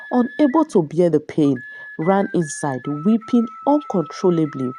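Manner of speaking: ordinary delivery, nothing marked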